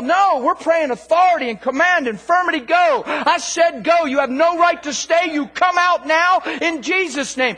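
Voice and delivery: high, loud rebuke of the sickness; commanding shout. Acted. Preacher demonstrates commanding a bad leg, shouted orders. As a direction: order the infirmity out with full authority.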